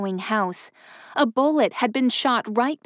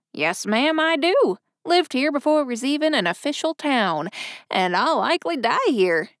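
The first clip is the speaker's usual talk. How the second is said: lightly voiced chatty old railroad man; a hint of a folksy old-timer; old station master voice. A narrator's reading.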